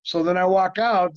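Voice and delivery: ordinary delivery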